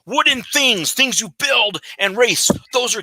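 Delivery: Viking voice. Acted. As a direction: proclaim it like a Viking warrior. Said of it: "You sound like a Viking".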